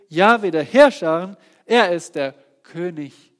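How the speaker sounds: proclaimed verse. Acted.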